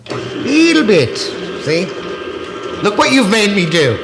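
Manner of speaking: high, loud mock-blaming cry; exasperated outburst. Real, not acted. Cook blaming partner for the mess, exasperated outburst. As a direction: shoot way up in pitch and blame them.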